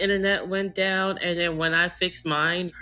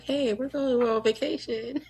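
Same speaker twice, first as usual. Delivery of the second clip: mock cheery; 8 semitones higher than usual. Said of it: "be like".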